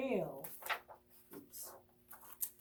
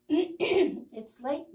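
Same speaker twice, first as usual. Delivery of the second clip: squeaking mouse; 6 semitones higher, 4 dB louder than usual. Acted. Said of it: "Mouse squeaks".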